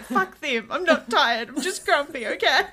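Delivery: normal talking voice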